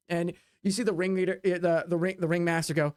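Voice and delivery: usual speaking voice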